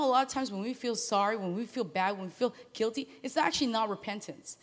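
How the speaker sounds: usual speaking voice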